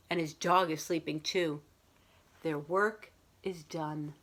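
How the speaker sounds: put-on children's picture book read aloud to a class; story time voice for young listeners; storybook reading. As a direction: read it like a teacher sharing a bedtime story. Teacher reads picture book aloud.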